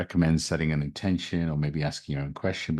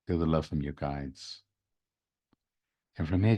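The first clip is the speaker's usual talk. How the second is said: guided meditation. Acted. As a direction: guide them into calm, gently.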